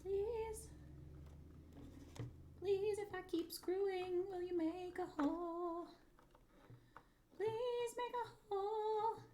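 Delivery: very high, sing-song begging; high pleading. Pretend. Crafter begging the screw for a hole, pleading high.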